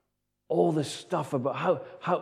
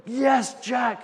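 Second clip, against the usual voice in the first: retold cheer of a crowd, raised and high; 6 semitones higher than usual. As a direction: cheer him on like the whole room. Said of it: "and everybody's cheering".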